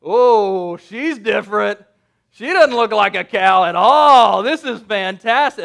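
loud, amazed outburst; hammed-up thrill at first sight; awestruck Adam. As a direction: marvel out loud, bursting with delight. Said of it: "you just see Adam looking up going".

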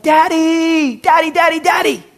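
put-on very high, urgent child's call; yelling little kid. Preacher contrasts formal Father with the kid's high yell. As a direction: cry out for dad in a kid's voice. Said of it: "he's not yelling. He's like".

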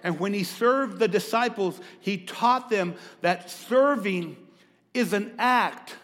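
loud, fervent preaching. Acted. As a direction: preach it with full force.

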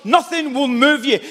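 ordinary talk, nothing marked

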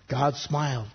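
ordinary talk

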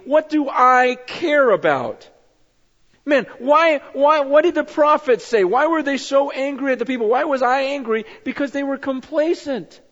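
God confronting Francis about the suffering; God rebuking. Retold or imitated. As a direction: thunder at him as God. God rebuking complacency, loud.

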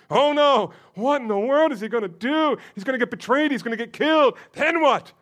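high, loud panicked reader; hammed-up worry; mock alarm. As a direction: act out the dread of a first reading. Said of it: "you're supposed to say that when you read this".

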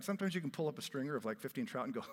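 normal talking voice

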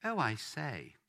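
put-on lightly posh English royal accent; a mild posh Queen-of-England touch. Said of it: "gonna be a bit posh about this".

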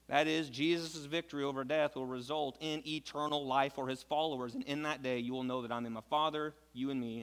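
ordinary talk

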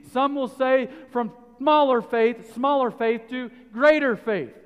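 loud, high preaching to the congregation; full-force preaching. Acted. Preacher pressing the point on faith, full force. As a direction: drive it home from the pulpit.

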